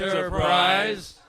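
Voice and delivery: stretched, mock-grand echo of the ship's name; dramatic echo. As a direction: draw the name out slowly like a booming echo. Running echo gag on ship name.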